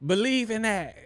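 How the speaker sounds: slow it down like a label shout-out; mock tough shout-out. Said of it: "I'm like".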